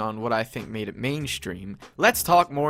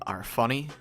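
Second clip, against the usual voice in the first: ordinary talk, nothing marked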